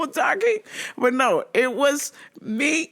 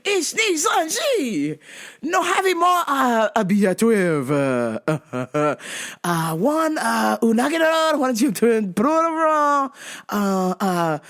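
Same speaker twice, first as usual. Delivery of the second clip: put-on terrible Japanese accent. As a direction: stall through it in mock Japanese-accented English. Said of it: "doing my terrible Oren Ishii accent".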